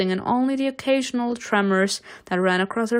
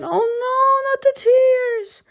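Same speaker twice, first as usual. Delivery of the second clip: very high, slow mock wail; squeaky high voice; 4 dB louder, slower than usual. Real, not acted. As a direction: squeak it out in dismay. Reacting to crying scene.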